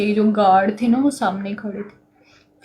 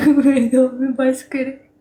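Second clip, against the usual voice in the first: laughing, slower and higher; 3 semitones higher, 4 dB louder than usual. Real, not acted. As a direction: laugh through it. Laughing about her mother.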